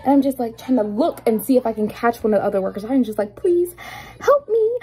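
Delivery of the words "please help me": high, pleading call for help; mock plea. Cashier begging coworkers to save her from the man, high-pitched plea. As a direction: beg for rescue, pitched way up.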